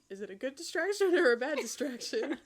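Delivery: giggling through a teasing question; laughing while reading. Real, not acted. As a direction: read the flirty line while cracking up. Cracks up reading own flirty line.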